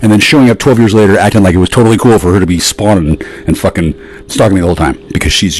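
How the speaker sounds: ordinary talk, nothing marked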